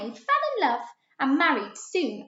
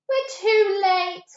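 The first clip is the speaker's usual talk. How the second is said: crying out; 9 semitones higher, 5 dB louder than usual. A narrator's reading. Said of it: "cried Bertie".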